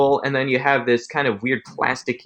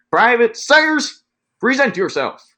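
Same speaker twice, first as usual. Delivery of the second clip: loud, high, very slow drawn-out call of a name; barked command; 12 semitones higher, 4 dB louder, slower than usual. Retold or imitated. Corporal calls Private Sayers out of the ranks, loud drawn-out command. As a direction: bark it like a drill corporal summoning a private.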